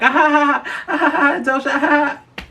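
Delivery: hammed-up high giggle of amused fans; mocking fan laughter. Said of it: "y'all were just like".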